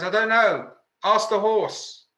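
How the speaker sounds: loud, high holler from horseback; shouted reply. Acted. The guy on the galloping horse answers a passer-by, shouting back. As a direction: call it back helplessly over the gallop. Rider calls back in a joke.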